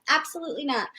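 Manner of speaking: firm refusal. Real, not acted. Mother refusing her little child, quiet and firm. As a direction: shut it down quietly.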